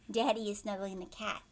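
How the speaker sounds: ordinary delivery, nothing marked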